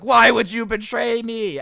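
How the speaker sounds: loud, drawn-out accusing cry; mock yelling. Old man yells at worker as his ex-wife, accusingly. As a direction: yell it slowly like a betrayed husband. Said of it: "starts yelling at her".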